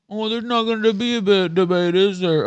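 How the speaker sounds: high nasal-free sneering parody; mock them in a whiny tone. Streamer mimicking complaining viewers, high mocking voice. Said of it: "free entertainment".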